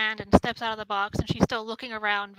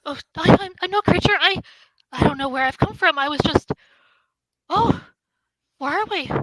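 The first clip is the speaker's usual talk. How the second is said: flustered, higher and louder, halting starts; bewildered young woman; 6 semitones higher, 7 dB louder than usual. Acted. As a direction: blurt it out in startled confusion. Plays bewildered woman from box.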